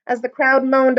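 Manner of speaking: usual speaking voice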